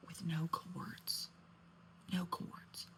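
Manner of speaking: slow, hushed whisper. Real, not acted. Storyteller whispering the shocking detail to her audience. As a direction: whisper it like a scandal.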